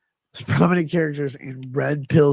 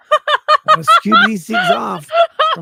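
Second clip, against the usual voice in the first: loud comic yell breaking into laughter; hammed-up shouting; 10 dB louder than usual. Ranting to the stream about corn chips, laughing yell. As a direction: rant at the top of your lungs, laughing.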